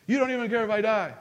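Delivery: high, quick mock complaint; hurt protest, played up; retold accusation. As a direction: imitate a son accusing his mom. Retells accusing his mom as youngster.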